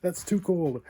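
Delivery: high squealing complaint; high-pitched. Real, not acted. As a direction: squeal it out from the cold. Reacting to extreme cold.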